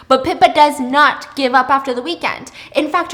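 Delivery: normal talking voice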